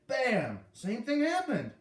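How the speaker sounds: outraged at being knocked down again; outraged exclamation. A narrator's reading.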